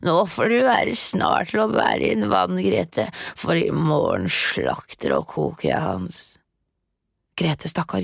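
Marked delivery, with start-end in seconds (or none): 0.1-6.2 impatient witch calling. Acted. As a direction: bark the order, impatient. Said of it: "ropte hun til Grete".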